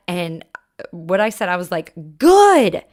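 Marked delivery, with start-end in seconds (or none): 2.2-2.8 loud, high snapped yell. Retold or imitated. Mom snapping back at upset son, loud yell. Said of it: "I apologize for yelling".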